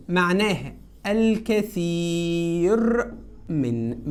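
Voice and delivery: slow, stretched meaning; drawn-out teacher explanation. Shown as a demonstration. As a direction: spell it out slowly for the class. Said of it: "ركز كده".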